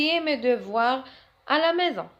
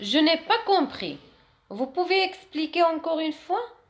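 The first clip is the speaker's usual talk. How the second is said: higher, slow polite request; slow model question; 6 semitones higher, 3 dB louder, much slower than usual. Shown as a demonstration. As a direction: ask the teacher to explain again, slowly. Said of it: "model phrase". (طلب مؤدب ببطء وبصوت أعلى)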